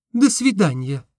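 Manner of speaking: высоко, девичьим голосом; прощание юной девушки. A narrator's reading. (девушка прощается с мечтателем, высоким девичьим голосом)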